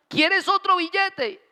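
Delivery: high, drawn-out mock father offering another bill; mocking dad's offer. Kid imitates dad offering money.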